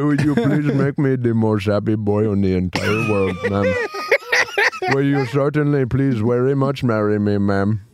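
put-on overly polite comic Indian accent; mock Indian accent. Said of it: "Indian gas station wedding proposals".

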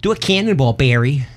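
wife's upstate New York accent, playfully imitated. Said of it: "starts saying things like".

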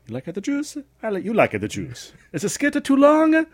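high, loud sing-song sketch imitation; imitating SNL sketch voice. Retelling the juice skit in a high loud sketch voice. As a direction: do the Adam Sandler juice skit. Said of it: "getting it wrong".

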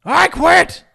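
loud, high mock yell; explosive imitation of an angry wrestler; yelled outburst. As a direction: bellow it, imitating an angry quitter. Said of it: "And then he yells".

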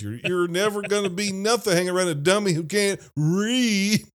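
dad scolding about the dummy; imitating the dad. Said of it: "when he says".

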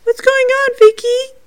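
put-on very high, loud little-boy voice; imitating Jacob. Showing viewers the spirit boy's words, high imitation. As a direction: mimic a distressed child far up in pitch. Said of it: "So you hear the".

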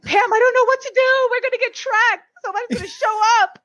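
high-pitched imitation of a panicking coworker; about to cry. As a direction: wail it in mock terror. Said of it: "I see her shaking and, like, about to cry".

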